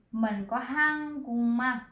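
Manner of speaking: hăng cung ma, slow, cúc softened to a nasal cung; nasalized ending. Shown as a demonstration. Teacher slowly models hăng cung ma for the class. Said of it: "Tại sao cô đọc là hăng cúc".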